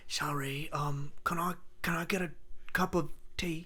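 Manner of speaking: soft, meek British request; mild mock British accent, meek and quiet. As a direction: politely ask for tea like a timid Brit. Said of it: "it's a British thing".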